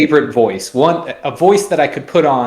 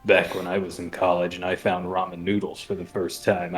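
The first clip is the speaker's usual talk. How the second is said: low Loxodon voice; 6 semitones lower, 10 dB quieter than usual. Retold or imitated. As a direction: lower and much softer, a mock deep reading. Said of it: "He gets real low".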